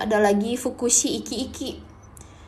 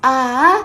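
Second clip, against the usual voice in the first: long sighing "a", louder, like a complaint; drawn-out complaining "a"; 8 dB louder than usual. Shown as a demonstration. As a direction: stretch the "a" out like you're grumbling. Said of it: "itu kayak ngeluh gitu ya". ("a" panjang mendayu-dayu, seperti mengeluh)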